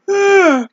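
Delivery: sharp high sigh of frustration; high exasperated sigh. Real, not acted. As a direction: let out a loud fed-up sigh. Frustrated about phone company.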